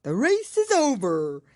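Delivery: loud, high call. A narrator's reading. Dodo calls out to the runners, loud. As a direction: announce it to everyone. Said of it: "suddenly called out".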